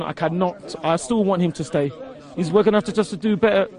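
normal talking voice

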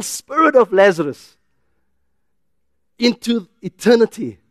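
high-pitched, urgent preacher's delivery; impassioned preaching. Acted. Preacher urging the congregation not to give up, impassioned and high.

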